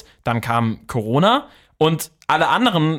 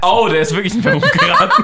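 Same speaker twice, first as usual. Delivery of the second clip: laughing surprise; 6 semitones higher, 5 dB louder than usual. Real, not acted. Reacts to the name Boateng.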